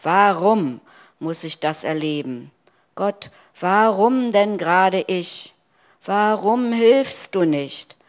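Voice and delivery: loud, high, anguished pleading; despairing outcry. Acted. Preacher voicing a believer crying out to God, loud anguish. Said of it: "unsere Verzweiflung hinausschreien".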